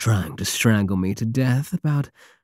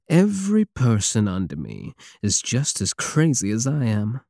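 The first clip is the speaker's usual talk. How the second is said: ordinary talk, nothing marked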